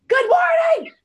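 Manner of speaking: very high, loud holler; shouted greeting. Shown as a demonstration. Walker greeting strangers with headphones on, shouted. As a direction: shout it so it gets through headphones. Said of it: "they have headphones on".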